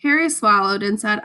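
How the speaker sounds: ordinary delivery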